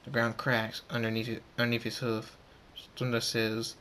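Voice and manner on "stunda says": ordinary delivery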